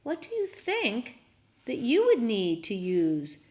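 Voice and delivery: plain reading voice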